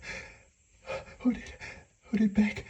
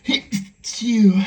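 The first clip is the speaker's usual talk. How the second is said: one loud achoo; sudden sneeze; 3 semitones higher, 9 dB louder than usual. Acted. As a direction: burst out with a sneeze. Sneeze while hiding.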